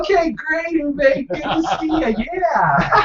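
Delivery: high, slow, overly cheerful chirp; oblivious cheerful. Retold or imitated. Mom cheerfully answering the grieving neighbor, high oblivious chirp. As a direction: beam at him without understanding a word. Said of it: "response was".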